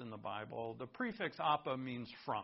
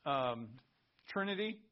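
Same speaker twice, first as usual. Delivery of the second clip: usual speaking voice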